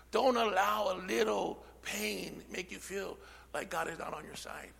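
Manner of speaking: usual speaking voice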